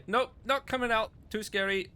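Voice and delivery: high, loud refusal from hiding; scared halfling. Acted. Frightened halfling calls to rescuers from behind rocks, high and loud. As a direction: balk from your hiding place in a high voice. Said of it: "as you hear".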